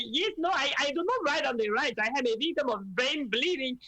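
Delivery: a hint of Venezuelan accent, quick; light Venezuelan accent. Mother still lightly imitating a Venezuelan rally woman. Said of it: "obsessed with her accent".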